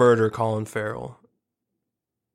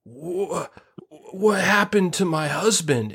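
shocked wife; 7 semitones higher, slower than usual. Acted. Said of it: "she's gonna be like".

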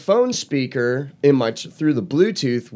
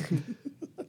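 laughter; 10 semitones higher, 13 dB quieter than usual. Real, not acted. Laughing after yelling at brother.

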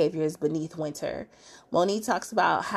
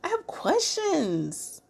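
over-the-top disbelief; 14 semitones higher than usual. Real, not acted. The host reacting to husbands shushing wives, very high mock outrage. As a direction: ham up the bafflement. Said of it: "I'm like".

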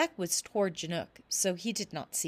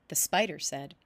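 plain reading voice

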